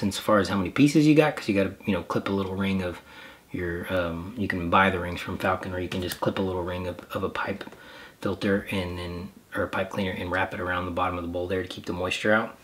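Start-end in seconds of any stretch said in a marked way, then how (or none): none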